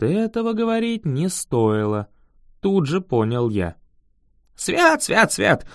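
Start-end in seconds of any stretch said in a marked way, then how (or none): 4.6-5.7 high-pitched muttered invocation; fearful muttering. A narrator's reading. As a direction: ward off evil, high and nervous. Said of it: "забормотал крестьянин".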